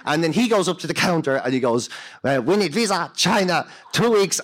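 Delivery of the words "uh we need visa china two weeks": put-on higher-pitched, pidgin Chinese-accented English; mock Chinese accent. Englishman at the visa counter, putting on a Chinese accent. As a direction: imitate a friend faking a Chinese accent. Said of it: "speak to them with a Chinese accent".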